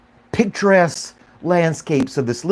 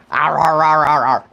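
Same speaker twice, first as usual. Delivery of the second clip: barking arr arr like the dog; dog bark imitation. Owner answering his dog by barking back arr. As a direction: mimic the dog's bark.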